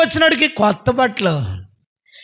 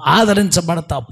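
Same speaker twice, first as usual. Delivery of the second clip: normal talking voice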